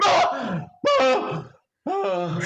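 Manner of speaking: high, loud frightened ah ah ah fading; imitating a friend's triple scream; mock terrified screams, dying away; startled screams. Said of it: "and he went".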